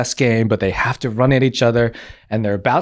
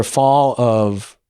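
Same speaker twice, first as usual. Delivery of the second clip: normal talking voice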